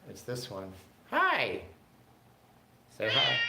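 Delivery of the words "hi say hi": excited hi. Real, not acted. Greeting the pet on camera.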